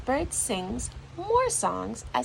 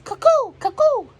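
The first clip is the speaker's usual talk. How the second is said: high, loud falling cuckoo; cuckoo call; 11 dB louder than usual. Retold or imitated. Narrator voices the cuckoo call, high and loud. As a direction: sing it like a cuckoo clock bird. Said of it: "the cuckoo says".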